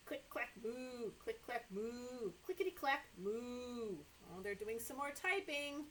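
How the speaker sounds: ordinary delivery, nothing marked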